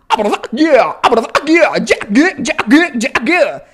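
scratch the words with a breaking throat; swallowed, squawking record-scratch imitation. Shown as a demonstration. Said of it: "Ahí ya suena el scratch".

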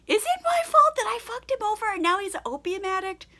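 high-pitched mock soul-searching; self-aware musing. Dorian questioning his blame for an addict, mock self-aware. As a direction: put on a high, wondering voice. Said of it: "trying to be self-aware".

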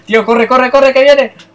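panicked high yell; being chased. Retold or imitated. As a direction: shout it as if fleeing for your life. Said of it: "aquí ya no es un te he dado, es un". (grito agudo de pánico huyendo)

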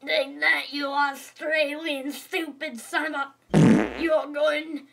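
loud, slow, strained threat; furious attack. Acted. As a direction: yell it out while striking. Character attacks the principal.

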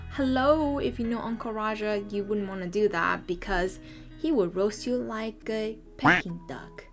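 normal talking voice

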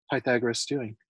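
usual speaking voice

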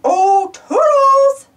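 stretched calling voice. Acted. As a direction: call Toodles drawn out. Calling for Toodles.

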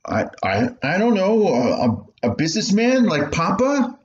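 put-on a kid guessing what to be; unsure boy. Boy unsure answering his mother.

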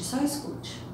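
whispered "só escute"; teacher quieting the class before listening. Real, not acted. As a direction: hush them before the audio. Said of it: "teacher hushes class".

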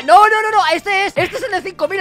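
sharp alarmed denial; panicked no. Real, not acted. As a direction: protest in rising panic. Panic, high and loud.

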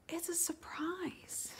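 slow, high, breathy whisper; secretive whisper. Acted. Ariel whispering to Flounder about the surprise, secretive. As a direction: keep the surprise hidden, whisper it.

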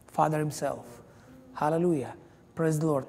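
ordinary delivery

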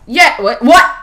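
very high, loud disbelieving whats; baffled shouting. Real, not acted. Gamer baffled by a death, shouting what. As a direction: yell in total confusion.

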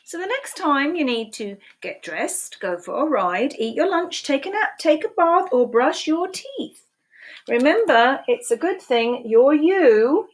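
lively picture-book read-aloud, questions pitched high; animated storytime voice with playful questions; reading to children. Acted. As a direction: read it like storytime for little ones.

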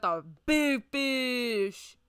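loud, drawn-out showy reveal; big announcement. Real, not acted. Streamer showing viewers the big fish exhibit, booming and drawn-out. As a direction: boom it out slowly, stretching the words.

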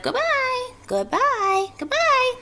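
very high, thin little farewells; tiny departing cries. A narrator's reading. Baby spiders call goodbye as they sail away, in tiny high cries. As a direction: call each one high and small, as if drifting off. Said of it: "came weakly to Wilbur's ears".

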